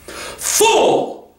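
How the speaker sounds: high, rasping witch snarl; savage snarl. A narrator's reading. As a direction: spit it out with savage contempt. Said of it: "savage smile that was almost a snarl".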